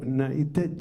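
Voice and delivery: ordinary delivery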